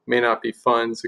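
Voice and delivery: ordinary delivery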